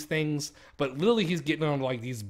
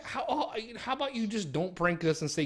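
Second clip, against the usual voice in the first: ordinary talk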